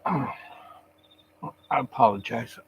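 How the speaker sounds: drowsy and slow, meds wearing off; heavy, sluggish, half-asleep delivery; sedated. Real, not acted. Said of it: "my meds are wearing off".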